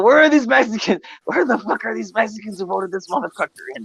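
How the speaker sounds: high-pitched retold disbelief; incredulous demand. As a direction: demand it, baffled and worked up. Acting out baffled reaction to vote.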